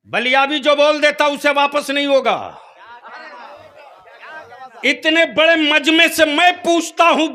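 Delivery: loud, high rally-style challenge; full-force preaching. Real, not acted. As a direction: throw the challenge out to a huge crowd. (जोशीली, बुलंद तक़रीर)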